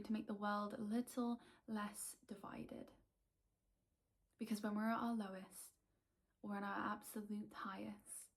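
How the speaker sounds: measured poem recital with long pauses, softening at line ends; poetry reading. Acted. This is the first poem I'm gonna read today.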